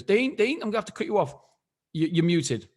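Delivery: imitating the host. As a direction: hurry to break in on the speaker. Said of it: "He goes".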